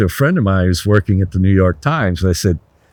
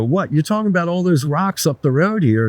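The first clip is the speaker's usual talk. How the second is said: baffled; 7 semitones higher than usual. Retold or imitated. Baffled by the teacher's claim.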